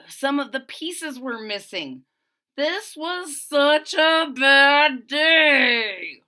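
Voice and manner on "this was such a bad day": slow, loud, higher lament; despairing wail. Acted. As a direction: drag it out like a kid at the end of his rope. Child despairs over missing pieces.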